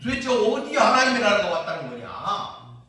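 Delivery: acting out scoffing disbelief; scornful jeering. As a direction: throw the question out in derision. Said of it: "그러니까 비웃는 거예요".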